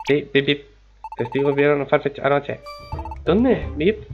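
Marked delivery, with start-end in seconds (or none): 3.3-3.7 surprised. Acted. Startled question in dialogue.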